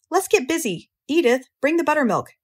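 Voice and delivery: high-pitched brisk orders; brisk rallying. A narrator's reading. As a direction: get everyone moving with high, lively commands. Zuckerman briskly rallies the family.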